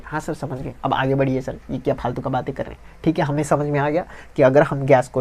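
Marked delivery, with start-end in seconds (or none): 0.0-2.8 soft, mock-bored students, the last line rushed; lightly voiced pupils grumbling to move on; impatient students. Teacher voicing bored students who want him to move on, soft and hurried.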